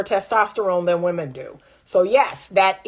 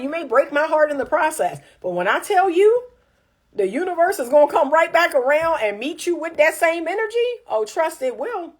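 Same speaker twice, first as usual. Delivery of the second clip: usual speaking voice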